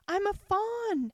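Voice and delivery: high, slow cry of delight. A narrator's reading. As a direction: burst out with joy, drawing it out. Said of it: "in a voice of delight".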